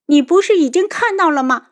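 high, loud sarcastic retort. A narrator's reading. Daughter retorting to her father, sarcastic. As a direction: throw it back at him mockingly. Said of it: "邱运竹讽刺的道".

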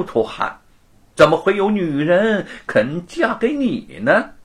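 high, loud sneering taunt with laughs; smug gloating. Acted. Mounted young master gloats over Wu, smug and mocking. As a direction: savour every insult. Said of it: "罗庞更是得意".

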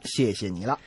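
ordinary talk

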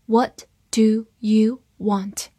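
"What... do... you... want", word by word; slow reading. Shown as a demonstration. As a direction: read slowly, separating each word. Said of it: "我们慢读一遍". (慢读，一词一顿)

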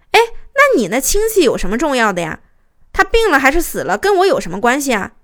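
loud, indignant imitation of a husband; mock yelling. Husband yelling at his wife about her relative, re-enacted. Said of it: "直接冲我嚷嚷".

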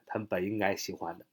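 ordinary talk, nothing marked

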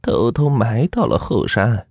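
put-on high, slow old-woman voice; drawn-out aged storyteller, pitched high; old grandmother confiding. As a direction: reveal a painful family secret slowly as granny. Voices grandmother revealing the secret.

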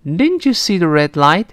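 Didn't you see the red light, lifting a little on light; slightly rising question. Shown as a demonstration. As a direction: ask it with a gentle rise at red light. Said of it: "声调往上是扬一点儿". (疑问句读red light时略微上扬)

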